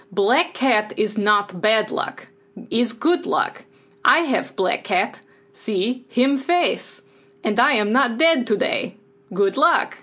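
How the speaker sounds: put-on slow, broken Russian-accented English. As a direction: mimic a Russian man talking to his cat, unhurried. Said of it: "one of the few accents I can kind of do".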